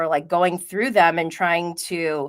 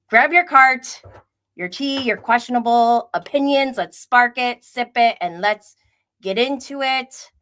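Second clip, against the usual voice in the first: louder, higher show-host kickoff; upbeat stream catchphrase, a bit louder; hyped opener; 5 semitones higher, 5 dB louder than usual. Real, not acted. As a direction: pump up the audience to start.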